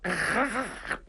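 soft, high "ha ha ha" chuckle; light teasing chuckle; mocking laugh. A narrator's reading. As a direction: laugh at his shame. Narrator mocks Zhang Fang's face.

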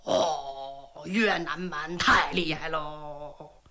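low, slow frightened crowd voice; panicked soldiers. A narrator's reading. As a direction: wail it as the routed troops. Enemy troops panic.

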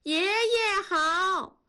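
put-on high, drawn-out children's greeting; children calling out. A narrator's reading. Children greet grandpa together, calling out high. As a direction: sing out like a group of kids. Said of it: "几个孩子一起回头喊".

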